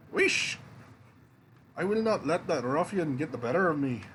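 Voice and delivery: put-on untrained movie Scottish accent for a king; a bluff Scottish king, in a movie Scottish accent. King recruiting the hero Jack, in a Scottish accent. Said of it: "my Scottish accent".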